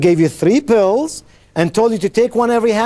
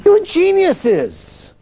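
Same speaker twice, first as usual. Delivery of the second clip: playful praise; 12 semitones higher, much slower than usual. Real, not acted. Praise after correct answer.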